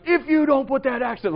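put-on a loud dad telling a kid to drop it; stern dad warning. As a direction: bark it out like an angry dad. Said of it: "I just get real dad".

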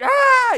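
sudden very loud, high scream; full-voiced irrational "Ah!" at the kids; mock losing-it yell. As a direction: explode in an acted-out temper outburst. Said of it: "Just, like, losing it, like, not logical".

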